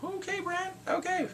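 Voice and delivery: high, sarcastic okay; exasperated. Real, not acted. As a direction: roll your eyes at Bran. Exasperated at Bran.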